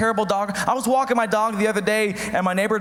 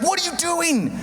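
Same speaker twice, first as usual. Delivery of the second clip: high-pitched mock scolding; alarmed wife; 7 semitones higher than usual. Wife catching husband downing vitamin pills, high alarmed scolding. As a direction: imitate a horrified wife telling him off.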